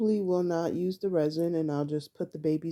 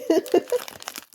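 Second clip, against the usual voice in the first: high laugh; 12 semitones higher, 6 dB louder than usual. Real, not acted. Bags keep coming out.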